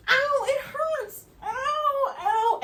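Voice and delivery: very high, slow ows; pained wailing. Retold or imitated. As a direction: wail the ows in pain. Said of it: "And so while I'm like".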